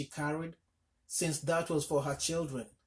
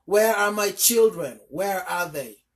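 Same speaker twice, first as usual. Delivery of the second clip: loud, higher frantic yell; 4 semitones higher, 11 dB louder than usual. A narrator's reading. Mother rushing the elephant with her knife, yelling. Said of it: "waving her knife and yelling".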